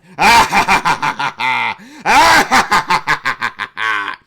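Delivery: long, shouted maniacal laugh; villain laugh. Acted. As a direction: laugh like a madman on a tower.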